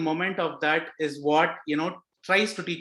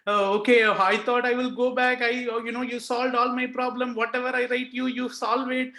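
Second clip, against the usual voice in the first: tearful plea to Swami; 6 semitones higher than usual. Retold or imitated. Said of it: "All this crying is happening".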